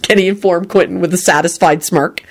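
ordinary delivery, nothing marked

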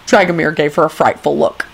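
ordinary delivery

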